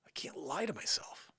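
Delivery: breathy aside to self; whisper. Real, not acted. Speaker muttering to audience, whispered. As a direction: let it slip under the breath.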